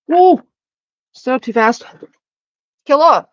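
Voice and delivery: calling out loudly off camera; yelling. Real, not acted. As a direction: shout it across the room.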